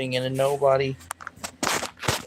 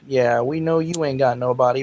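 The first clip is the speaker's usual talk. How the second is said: ordinary talk, nothing marked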